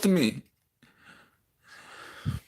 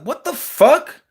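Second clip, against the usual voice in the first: much higher, stunned outburst of disbelief; shocked disbelief; 9 semitones higher, slower than usual. Retold or imitated. Son stunned by his mother's nasty reply, shocked disbelief. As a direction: recoil in shock at a sudden insult.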